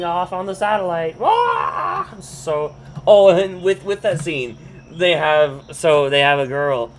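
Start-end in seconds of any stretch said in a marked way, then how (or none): 1.2-2.1 high, drawn-out "waah"; imitated flying-off wail. Helper flying off on the satellite, high wail. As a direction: wail like someone hurtling away. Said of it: "whole scene of Helper being flying".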